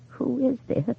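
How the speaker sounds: put-on frail frightened old woman; weak, quavery. A narrator's reading. Frightened widow answers the strange caller, weak and quavery. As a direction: quaver it out, barely holding on. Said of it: "Her voice was weak, quavery".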